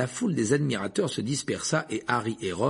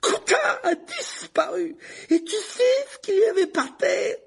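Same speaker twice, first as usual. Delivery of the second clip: very high, strained angry cry; furious accusation; 5 dB louder, slower than usual. A narrator's reading. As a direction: hurl the accusation at her. Ron accusing Hermione.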